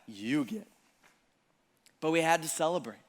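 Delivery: ordinary talk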